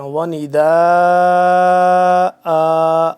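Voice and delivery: loud, long-held ending on وَنِدَاءً; recited pause form. Shown as a demonstration. Teacher shows students the stopping form, long held chant. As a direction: stretch the last vowel of وَنِدَاءً as at a stop. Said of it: "یہ وقف کی صورت میں ہوگا".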